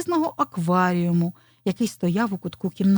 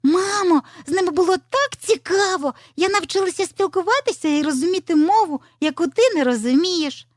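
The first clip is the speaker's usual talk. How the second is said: high-voiced little fox bubbling over; thrilled child character; excited telling; 10 semitones higher, 3 dB louder than usual. A narrator's reading. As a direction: gush to mum, pitched well up. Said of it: "почала захопливо розказувати".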